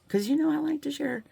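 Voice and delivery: lilting and high, teasing; playful singsong. Acted. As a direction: sing it out teasingly. First take, sung high.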